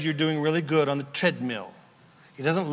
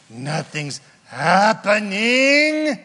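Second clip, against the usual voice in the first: mock whining complaint; 4 semitones higher, 5 dB louder, much slower than usual. Voicing frustrated believer.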